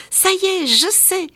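cri aigu et fort de joie; lance-le tout excité comme un enfant; s'écrie joyeux. A narrator's reading. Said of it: "s'écria Petit Prince Pouf". (s'écrie tout joyeux)

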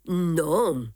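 usual speaking voice

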